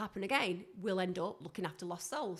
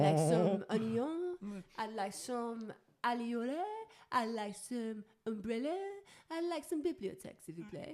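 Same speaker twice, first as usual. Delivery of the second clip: slow mock-French pleading; hammed-up French shopper, unhurried; French accent; 4 dB quieter than usual. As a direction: draw out a comic French request.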